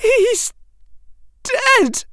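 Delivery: very high, breaking wail; anguished wail. Acted. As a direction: cry it out in anguish. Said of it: "The anguish in his voice".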